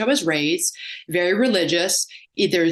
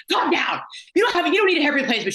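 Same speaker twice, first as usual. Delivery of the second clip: blunt scolding friend; 9 semitones higher, faster than usual. Retold or imitated. Friend scolds her catastrophizing.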